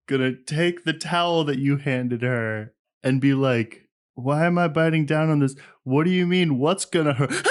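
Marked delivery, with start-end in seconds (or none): 4.1-7.5 high, rising alarmed protest; panicked patient. Pretend. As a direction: play a patient panicking into a scream. Acting out the patient panicking before pain.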